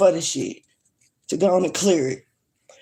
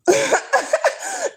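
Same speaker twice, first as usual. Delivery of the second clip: short high-pitched laugh; quick high giggle breaking the rant. Real, not acted. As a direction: burst into a high laugh mid-sentence. Laughs mid-rant about neighbors.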